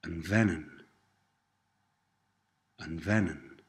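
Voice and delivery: vennen starting with a clear V, not a B; soft V in vennen. Shown as a demonstration. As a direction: model the softened V in vennen. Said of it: "softens to a V sound".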